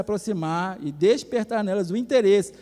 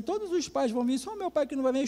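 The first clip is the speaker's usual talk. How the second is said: put-on higher, mildly tearful child's complaint; retold tearful child; 5 semitones higher, 4 dB quieter than usual. Girl tells pastor her father won't come, mildly tearful. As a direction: voice a little girl close to tears, lightly. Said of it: "a Vitória estava chorando".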